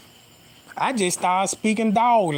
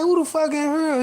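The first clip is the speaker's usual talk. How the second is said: higher, startled who-the-hell; startled shout-question; 9 semitones higher than usual. Retold or imitated. Finding his bathroom door locked, startled higher call. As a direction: react like someone's in your locked bathroom.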